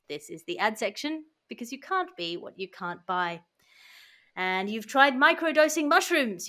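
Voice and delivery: ordinary talk, nothing marked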